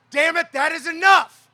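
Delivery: loud high rallying cry; rallying shout. Real, not acted. Union leader leading the crowd, shouting the refrain. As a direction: throw it to the crowd. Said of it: "people rise up and say".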